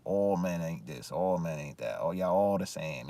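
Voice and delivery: low, quiet mocking of women's complaints; grumbling the women's line; muttered mock quote. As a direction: mutter it under your breath like a dismissive echo. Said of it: "they always talk about".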